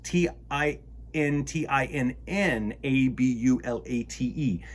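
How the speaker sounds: ordinary talk, nothing marked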